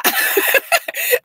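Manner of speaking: high, giggly burst of laughter; real laugh. Real, not acted. Amused host before asking Ananda a question, laughing.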